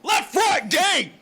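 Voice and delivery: high, loud hype shout; hype man shout. Acted. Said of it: "That was my hype man".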